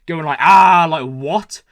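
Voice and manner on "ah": a short loud imitated scream of "Ah"; mock screaming noise. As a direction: belt out one sudden loud "Ah" like a scream. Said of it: "a screaming noise".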